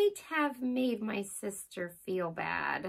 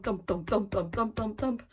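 normal talking voice

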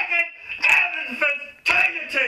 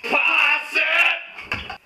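yelled command; much slower than usual. Acted. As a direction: yell at the players to pass. Yelling at players.

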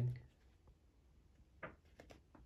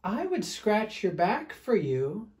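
higher, lilting storybook bear; voice from above; 10 semitones higher, 6 dB louder, slower than usual. Acted. Fluffy bear up a tree to smelly bear, higher teasing call. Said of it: "said a voice from above".

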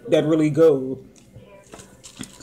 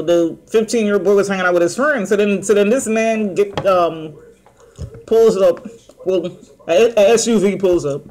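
eating fries while talking; talking while chewing. Real, not acted. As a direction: mouth partly full. Seen 2: Puts fries in mouth just before, chews with hand near mouth while speaking.

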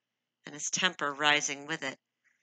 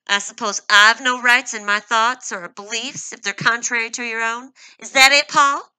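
higher and much louder, rising to a shout; growing anger; 7 semitones higher, 16 dB louder than usual. A narrator's reading. Angry wife challenging her husband, rising to a shout. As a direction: build the fury to his name. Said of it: "Her own anger was growing swiftly".

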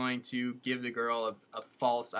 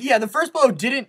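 excited, loud; 11 semitones higher, 13 dB louder than usual. Real, not acted. Excitedly explaining the smash.

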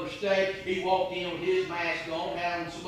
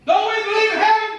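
much higher and louder, heaven rising at the end; impassioned outcry; 8 dB louder, faster than usual. Real, not acted. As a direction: hurl the question at them, pleading. Preacher repeats, louder and higher.

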